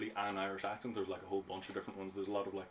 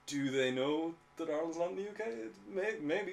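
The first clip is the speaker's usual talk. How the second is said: incredulous; 9 semitones higher than usual. Real, not acted. Incredulous about Ireland in UK.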